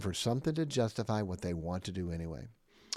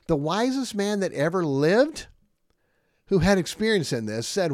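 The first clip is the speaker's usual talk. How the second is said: preaching at full force; 8 semitones higher, 9 dB louder than usual. Acted. Preacher builds to a peak.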